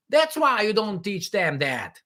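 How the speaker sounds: ordinary delivery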